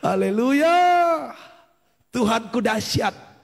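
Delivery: hallelujah rising high at the end; drawn-out, climbing hallelujah; rallying praise cry. Real, not acted. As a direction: call out praise to the congregation.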